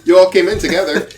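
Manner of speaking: high, loud, quick objection; incredulous protest. Acted. Merchant protests to the party.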